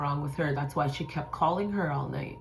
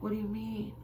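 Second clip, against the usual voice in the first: soft, higher evasive reply; something wrong; 6 semitones higher, 9 dB quieter than usual. Retold or imitated. Sister dodging the question, quiet and guarded. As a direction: ask it small and quiet. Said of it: "I could tell in her voice".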